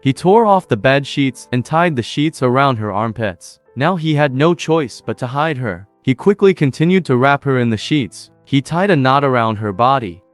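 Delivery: usual speaking voice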